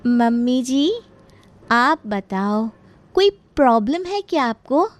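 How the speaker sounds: drawn-out address, high questioning finish; pointed sweetness. Acted. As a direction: prod mother-in-law with loaded politeness. Daughter-in-law pointedly prods mother-in-law.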